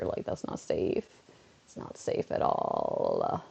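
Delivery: low, slow unvoiced rasp; slow raspy "not safe at all"; creepy whisper. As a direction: warn them in a ghostly whisper. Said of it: "it'd be like".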